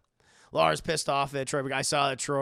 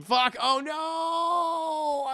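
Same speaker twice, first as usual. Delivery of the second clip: shocked cry; 12 semitones higher, 5 dB louder, much slower than usual. Retold or imitated. Reenacting cursing on stream.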